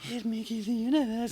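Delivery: a milder Death voice, not the first one; Death, toned down. Retold or imitated. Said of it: "well, not in that voice".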